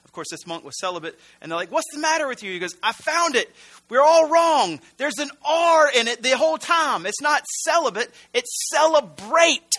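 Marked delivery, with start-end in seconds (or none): 1.7-2.5 much higher, insistent bewildered question; mock alarm. Monks questioning their crazed brother, mock-alarmed. Monks confront frantic monk in joke. 2.8-9.7 high, loud frantic outburst, hammed up; mock freak-out. Frantic monk telling the others they're all wrong, hammed-up outrage. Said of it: "he's just freaking out down there".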